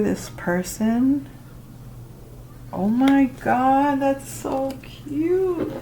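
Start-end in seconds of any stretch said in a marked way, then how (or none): 2.7-5.7 cooing. Real, not acted. Gushing over sweet card.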